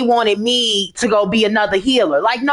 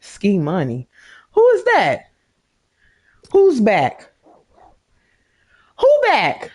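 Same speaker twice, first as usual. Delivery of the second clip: puzzled questions pitched much higher; surprised; 9 semitones higher than usual. Real, not acted. Surprised at someone joining her game party. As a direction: ask high and startled who that is.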